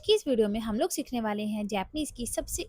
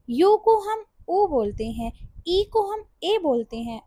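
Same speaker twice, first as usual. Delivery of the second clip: normal talking voice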